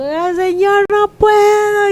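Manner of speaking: high, drawn-out mock wail; wailing prayer, acted out; mock crying. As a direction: imitate a tearful, hammed-up plea. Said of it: "cuando estoy llorando y cuando me estoy revolcando".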